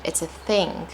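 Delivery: ordinary talk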